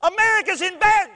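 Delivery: high, loud fretting; mock panic. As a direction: burst out high and alarmed, ready to be cut off. Mock fretting, then hushed.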